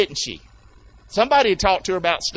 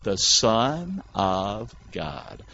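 very slow, low, drawn out in unison; congregational response; 9 semitones lower, 5 dB quieter, much slower than usual. Shown as a demonstration. Preacher leads church in saying it, slow and low. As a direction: lead the church through it slowly. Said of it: "say it with me".

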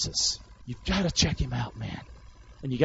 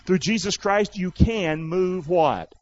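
usual speaking voice

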